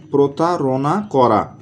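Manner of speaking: slow, deliberate teacher repeat; drawn-out classroom repetition. Shown as a demonstration. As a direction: spell out প্রতারণা করা slowly for students. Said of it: "teacher repeats meaning slowly".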